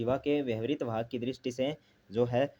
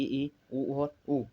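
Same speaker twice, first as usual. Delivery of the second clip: slowly listing vowels; much slower than usual. Shown as a demonstration. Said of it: "चार है ई".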